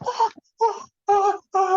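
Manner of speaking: high mock screams of fright from the passenger seat; retold panicked screaming. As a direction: mimic a terrified man yelping as the car takes off. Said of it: "he starts screaming and carrying on".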